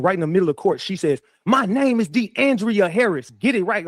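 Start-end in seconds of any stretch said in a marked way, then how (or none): 1.5-3.9 high, slow mock outburst, each word laid out; pitched-up imitation of an indignant correction; retold outburst. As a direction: blurt the name out across the courtroom. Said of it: "she just blurts out".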